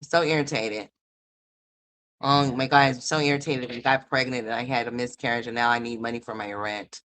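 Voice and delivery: hammed-up sulking, pitched low; mocking imitation. Mocking the tenant's complaints.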